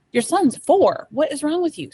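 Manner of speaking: exasperated telling-off; retold exasperation. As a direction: scold him in disbelief, as retold. Retold exasperated rebuke of father.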